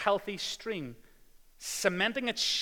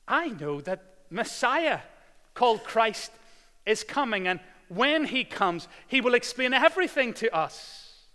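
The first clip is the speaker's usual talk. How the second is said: loud, high full-force preaching; 6 semitones higher, 9 dB louder than usual. Acted. Preacher retelling Jesus to the congregation, loud and high. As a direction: proclaim it to the whole church. Preacher voicing the woman loudly.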